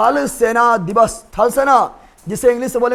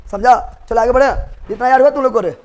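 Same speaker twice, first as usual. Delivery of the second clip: usual speaking voice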